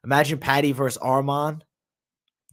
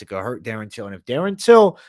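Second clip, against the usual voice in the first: ordinary delivery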